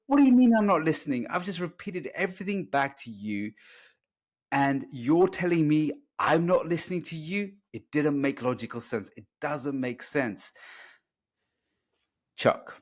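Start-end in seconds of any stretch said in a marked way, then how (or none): 0.1-7.5 exasperated retort. Retold or imitated. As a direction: replay the disbelieving protest, raised high and pushed. Retold quarrel, his exasperated reply.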